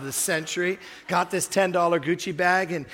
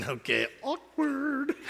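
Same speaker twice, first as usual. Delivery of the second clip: much higher, slow, drawn-out "awkward"; awkward aside; 8 semitones higher, slower than usual. Retold or imitated. Preacher imitating his own thoughts as the girl cries, awkward. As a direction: let the discomfort stretch the words. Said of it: "I was sitting there like going".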